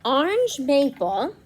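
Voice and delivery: ordinary delivery, nothing marked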